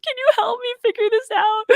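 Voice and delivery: high, teary, pleading wail; retold crying plea. Tearful plea to Joey over sore hands. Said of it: "I'm crying every night to Joey".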